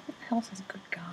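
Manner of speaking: unvoiced amused aside to the listener; whispered aside. Real, not acted. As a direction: whisper it off-script, bemused. Reader's aside mocking text.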